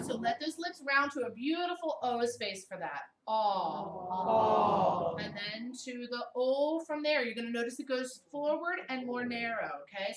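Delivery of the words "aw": Aw held loud and open; teacher modelling aw; sustained aw. Shown as a demonstration. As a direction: hold the open aw. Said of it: "just do the aw".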